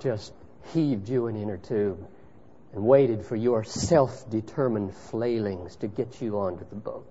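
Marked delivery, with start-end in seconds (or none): none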